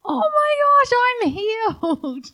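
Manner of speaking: high, loud cry of joy; overjoyed shout. Retold or imitated. Healed Samaritan shouts his thanks to Jesus, overjoyed. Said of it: "he's shouting".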